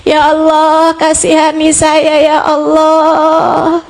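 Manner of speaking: acted plea for mercy, long drawn-out vowels; slow, imploring prayer on a sustained note; tearful supplication. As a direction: plead with God as if weeping. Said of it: "dengan linangan air mata kamu bilang".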